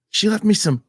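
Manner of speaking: put-on very high little-kid voice; seven-year-old daughter. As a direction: imitate a child showing dad a find. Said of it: "she looks and she goes".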